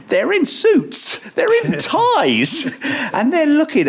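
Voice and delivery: high, slow, squeaking with laughter; real incredulous laughter in the voice; laughing disbelief. Real, not acted. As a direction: stretch it out in giggling disbelief.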